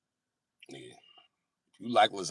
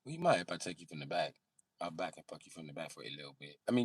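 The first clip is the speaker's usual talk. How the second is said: usual speaking voice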